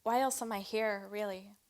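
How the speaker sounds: measured spoken-word delivery with weighted pauses; poetry reading, pausing between images; poem recitation. Acted.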